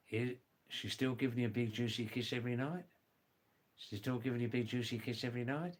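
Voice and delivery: fast, run-together casual question; quick question rising at the end; rapid colloquial example. Shown as a demonstration. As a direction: rattle it off like everyday slang.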